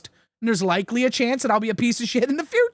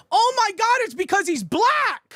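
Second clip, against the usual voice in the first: much higher, hammed-up shocked gasp; mock outrage; 10 semitones higher than usual. Mocking the accusers with hammed-up shock. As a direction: play it like a fake scandalized bystander.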